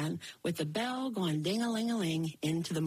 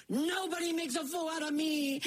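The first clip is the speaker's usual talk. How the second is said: high giant bellow with fist raised; angry giant; 7 semitones higher than usual. Acted. Giant raising his fist at Jack, angry. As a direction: threaten him.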